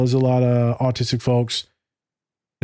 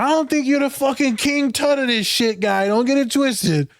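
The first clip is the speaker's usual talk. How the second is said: mock-scolding friend; 12 semitones higher than usual. Client teasing the therapist about his ego, in a high mocking voice. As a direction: playfully put him in his place, pitched way up.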